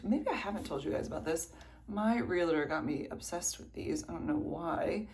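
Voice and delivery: usual speaking voice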